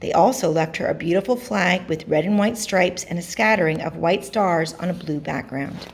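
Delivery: ordinary delivery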